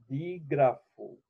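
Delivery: usual speaking voice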